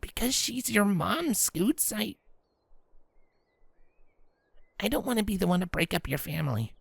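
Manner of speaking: gentle reluctance. Acted. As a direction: say it carefully, unwilling.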